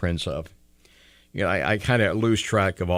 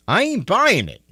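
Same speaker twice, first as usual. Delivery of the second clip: scoffing; 11 semitones higher, 4 dB louder than usual. Real, not acted. Host mocking survey, higher.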